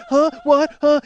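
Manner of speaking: hammed-up clueless panic; mock bewilderment. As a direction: play a man totally lost. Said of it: "doesn't know what the fuck to do".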